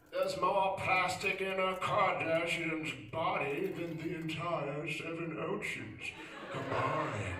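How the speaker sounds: slow, hushed nature documentary voice; Attenborough narration. Retold or imitated. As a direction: narrate like a wildlife film. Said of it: "All right, David".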